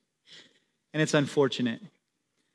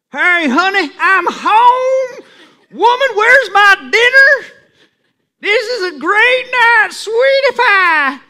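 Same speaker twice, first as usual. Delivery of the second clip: much higher and louder, hammed-up put-on tone; fake pious voice; 13 semitones higher, 8 dB louder, slower than usual. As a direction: ham up a showy churchy voice to the wife. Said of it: "You don't talk like that".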